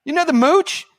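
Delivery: high, loud call offstage; calling to producer. Retold or imitated. Host calling to producer Cassandra, high loud call. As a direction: shout across the room to a colleague. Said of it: "I go".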